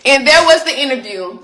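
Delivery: delighted laugh at the reveal. Real, not acted. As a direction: repeat it again, laughing now. Repeats line, then laughs.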